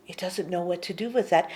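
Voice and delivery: ordinary delivery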